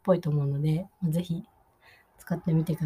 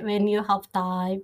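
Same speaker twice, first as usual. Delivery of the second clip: slow demo phrase; much slower than usual. Shown as a demonstration. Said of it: "ていう言い方ですね".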